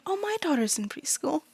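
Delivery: breathy whisper right at your ear; whispered aside. Acted. Stranger a foot away whispering to the party. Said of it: "a foot away from you go".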